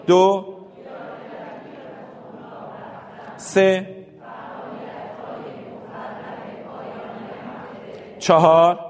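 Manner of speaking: teacher counts aloud for the class; loud group count. Shown as a demonstration. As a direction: call each number out to the class. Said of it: "همه با هم با صدای بلند".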